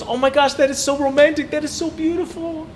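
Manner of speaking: high, swooning wife's voice; imitating his fiancée. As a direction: gush over it like the fiancée on the barge. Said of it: "she goes".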